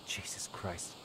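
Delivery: whispered curse under breath; whispering. Real, not acted. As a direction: breathe it out, barely voiced. Muttered curse at game.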